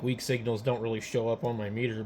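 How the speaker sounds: usual speaking voice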